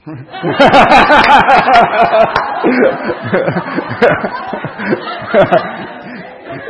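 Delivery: real laugh. Real, not acted. Congregation laughs at accent joke.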